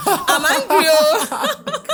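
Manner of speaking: loud, high cry through laughter; mock-angry outburst breaking into laughter; laughing mock anger. Wife retelling waiting for his call after an argument, laughing mock anger.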